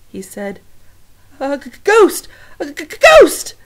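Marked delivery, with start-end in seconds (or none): 1.4-3.5 loud, high, stuttering cry of fright; stammering fear. Acted. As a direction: stutter out ghost in terror. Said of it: "he raised a shaking paw".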